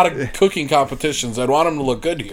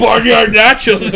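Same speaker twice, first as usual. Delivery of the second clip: hype announcer; 6 semitones higher, 6 dB louder, much slower than usual. Pretend. Hyping a dish name.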